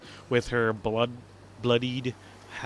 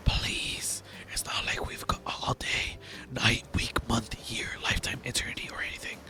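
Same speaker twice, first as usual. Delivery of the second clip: whispered, unvoiced and much softer; 5 dB quieter than usual. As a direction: keep it down to a hushed whisper. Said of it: "I'm still whispering, though".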